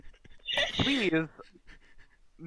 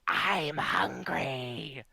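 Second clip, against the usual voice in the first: slow, menacing spider growl; much slower than usual. Acted. Spider telling the druid, slow and menacing. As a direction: drag it out like a hungry beast. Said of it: "the spider just responds with".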